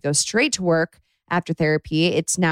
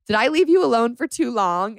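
high, cutesy imitation of a partner; Max; 6 semitones higher than usual. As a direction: coo it, pitched up, as a doting partner. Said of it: "Or he says".

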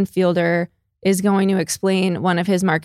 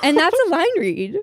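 high emphatic; 8 semitones higher than usual. Acted.

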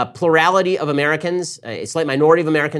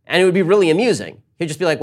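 ordinary talk

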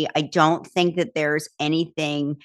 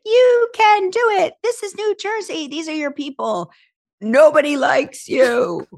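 inner voice; 9 semitones higher than usual. Retold or imitated. Kelly voicing the anxious voices in her head, high and manic. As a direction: play a manic inner voice, pitched way up. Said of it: "as the voices started going, one was like".

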